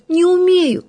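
put-on little girl voice. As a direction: answer like a small shy girl. Голос Феньки. (тоненький голосок девочки)

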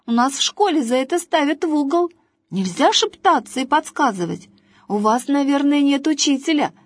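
high, bossy boy playing teacher; chatty boy. A narrator's reading. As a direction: scold like a little teacher.

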